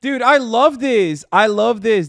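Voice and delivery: delighted loud cheer; excited. Real, not acted. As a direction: burst out with real delight. Delighted reaction to clip.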